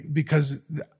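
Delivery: ordinary delivery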